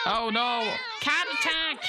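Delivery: hammed-up fright at a cat ambush; mock panic. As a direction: yelp it like the cats are pouncing. Cats swarm her at the door.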